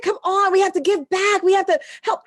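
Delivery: high-pitched, insistent coaxing; retold plea, pushing a reluctant sister; urging plea. Retelling pleading with her sister.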